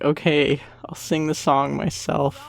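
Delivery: normal talking voice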